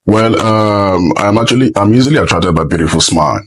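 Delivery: normal talking voice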